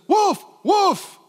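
two high, loud barked cries; shouted warning. Shown as a demonstration. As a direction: call out the danger to your teammate. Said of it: "A good coach says".

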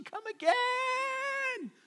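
high, very slow drawn-out come again; drawn-out plea. Real, not acted. Preacher longing for Christ's return, a high drawn-out plea. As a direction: stretch out come again as a pleading cry. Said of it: "I'm like".

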